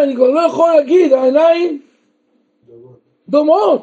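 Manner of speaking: normal talking voice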